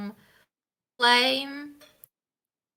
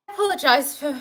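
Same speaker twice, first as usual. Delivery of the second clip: in-character apology; 6 semitones higher, 4 dB louder, much faster than usual. Acted. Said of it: "Shepard says".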